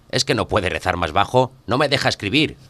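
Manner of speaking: high, loud irritated complaint; angry shout. A narrator's reading. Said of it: "grita Krasnukin". (Krasnukin grita enfadado al vecino)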